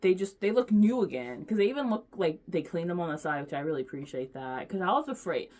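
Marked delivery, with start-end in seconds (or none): none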